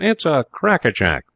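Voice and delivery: high, approving American exclamation; American accent. A narrator's reading. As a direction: praise it in a broad American accent. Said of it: "in a marked American accent".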